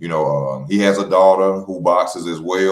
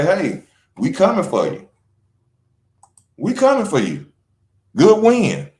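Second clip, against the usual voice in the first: rival boasting "we coming for you" in a higher voice; mock rival congratulations; 9 semitones higher than usual. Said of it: "congratulate him and say".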